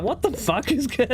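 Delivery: high, slow disbelieving yelp of shock; shocked outburst. Retold or imitated. Retold shock at PC corrupting.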